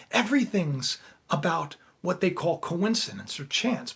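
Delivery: ordinary talk, nothing marked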